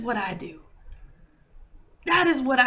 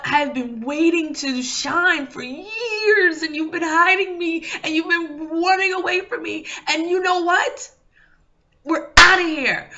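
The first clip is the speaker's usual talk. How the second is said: higher and louder, voice breaking with tears; tearful outburst; 5 semitones higher, 5 dB louder than usual. Retold or imitated. Inner self pleading with the speaker, tearful and rising. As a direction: let the hidden self cry out at last.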